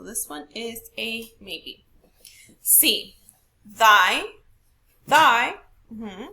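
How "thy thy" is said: THY punched loud, each time; stress on THY. Shown as a demonstration. Teacher testing option C with class, stressed. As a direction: hit "Thy" hard as a stressed syllable. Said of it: "we're only looking at the capital letters".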